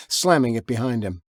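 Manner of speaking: plain reading voice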